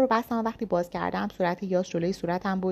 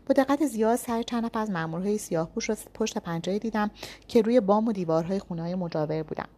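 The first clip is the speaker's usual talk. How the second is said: ordinary delivery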